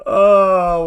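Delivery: high, loud, slow laugh. Real, not acted. Streamer cracking up at his rival's clip with a high, loud laugh.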